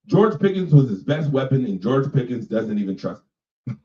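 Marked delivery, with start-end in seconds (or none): none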